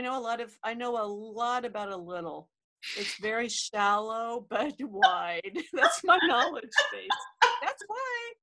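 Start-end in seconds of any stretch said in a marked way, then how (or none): 4.5-8.3 laughing while talking. Real, not acted. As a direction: speak through a laugh. Laughs at her own joke.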